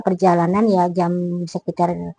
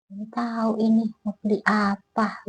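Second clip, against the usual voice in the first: eerie vendor; 8 dB quieter than usual. Retold or imitated. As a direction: mimic the spaced-out seller, quiet and dragging. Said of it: "tuh bengong aja".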